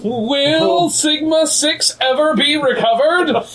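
slow, high dramatic teaser; milk the suspense slowly; cliffhanger hushed stop. Pretend.

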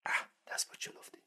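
whispered, irritated self-scolding; annoyed whisper. Retold or imitated. Scolding yourself in the kitchen, in an annoyed whisper. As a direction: hiss it at yourself after dropping the spoon.

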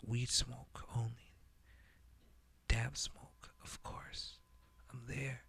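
hushed whisper of a shadowy leader. Acted. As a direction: breathe it like a secret threat. Whispered menacing persona boast.

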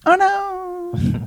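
high, soft, drawn-out tiny cry; small voices. Acted. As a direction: squeal softly like tiny doomed creatures. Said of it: "three distinct small voices".

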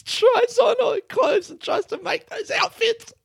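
very high squeaky voice through laughter; real helpless giggling while talking; cracking up. Real, not acted. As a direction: barely get the words out for laughing. Breaks up describing the video.

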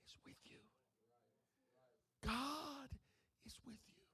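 very soft, spaced-out reassurance; hushed preaching. Real, not acted. Pastor answering troubled members that God is with them, hushed preaching. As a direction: let each phrase sink in, nearly down to a whisper.

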